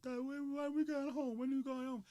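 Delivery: imitating a tearful kid; crying child. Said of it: "the kid's cr".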